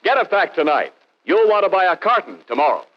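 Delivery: ordinary delivery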